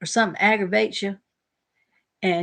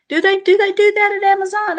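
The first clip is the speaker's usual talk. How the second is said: surprised; 12 semitones higher, 8 dB louder than usual. Real, not acted. Surprised question.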